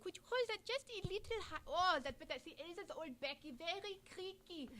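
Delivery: high, soft doll voice; doll puppet voice. Acted. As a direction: voice a fragile old doll. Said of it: "So here we have Elsa".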